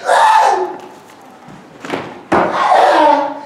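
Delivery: loud imitated "ah" outburst; sudden mock spirit-filled yell; mock worship cry. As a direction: mimic a churchgoer suddenly crying out. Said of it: "when I be seeing certain people".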